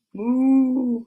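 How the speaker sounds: long drawn-out moo; cow moo. Acted. Storyteller voicing a cow, long moo. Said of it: "says a cow".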